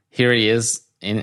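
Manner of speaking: ordinary talk, nothing marked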